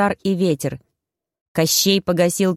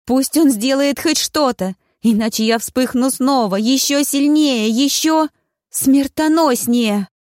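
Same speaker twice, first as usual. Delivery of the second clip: panicked pleading; 5 semitones higher than usual. A narrator's reading. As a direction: beg before you burn again. She begs in panic, burning.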